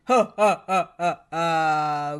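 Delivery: loud thunderous ha-ha-ha; giant's booming laugh. A narrator's reading. Giant laughing at Mister Greedy, booming. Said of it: "laughed a laugh as loud as thunder".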